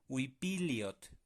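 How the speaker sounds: "Huipiliot" despacio, li y ot juntos; sílabas unidas. Shown as a demonstration. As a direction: funde li y ot en una sílaba. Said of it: "li, se va a juntar con ot". ("Huipiliot" lento y unido)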